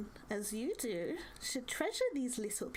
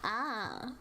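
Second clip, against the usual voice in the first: crackly crone voice; 6 semitones higher, slower than usual. Acted. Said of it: "her voice crackles like dry weeds".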